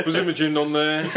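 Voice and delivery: high, loud, giggling; mock the question while laughing. Real, not acted. Mocking the friend's question.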